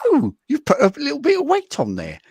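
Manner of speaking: high, loud mock British mum; British mum accent. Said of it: "doing an accent of her mum going".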